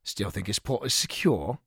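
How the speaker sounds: low, soft grumble; low disgruntled muttering; grumbled. A narrator's reading. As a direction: grumble it sceptically under your breath. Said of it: "grumbled Colin".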